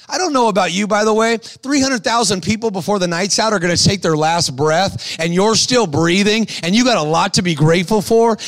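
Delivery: ordinary talk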